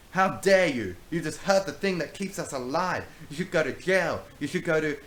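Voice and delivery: high, loud scolding; toned-down outraged yell. Acted. Rachel berating Brownbeard over the tree, loud outrage. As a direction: scold him hard but contained. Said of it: "Rachel screamed".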